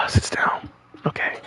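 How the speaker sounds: breathy, voiceless muttering; tense whisper. Real, not acted. Gamer steadying himself after the tense choice, tense whisper. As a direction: whisper it to yourself with long exhales.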